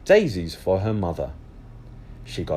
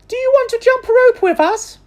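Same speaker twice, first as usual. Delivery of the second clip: put-on very high, louder little girl; high girl calling; 10 dB louder than usual. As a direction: call out to a friend. Rose invites Amelia.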